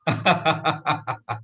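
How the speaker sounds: hearty demonstration laugh; demo laugh. Shown as a demonstration. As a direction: belly laugh on cue. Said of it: "I will show you how to do that".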